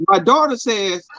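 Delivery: normal talking voice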